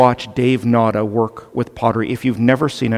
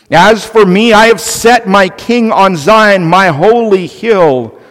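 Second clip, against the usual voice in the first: higher, louder booming decree; proclaiming scripture; 5 semitones higher, 6 dB louder than usual. Acted. Preacher voices God's angry decree, higher and louder. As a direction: thunder God's words over the rebels.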